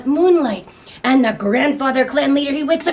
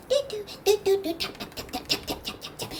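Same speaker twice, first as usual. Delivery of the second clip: quiet, rapid vocal tapping noises; imitated tapping; 7 semitones higher, 10 dB quieter, much faster than usual. Grandson playing on the box, soft busy tapping noises. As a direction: patter tiny percussive syllables.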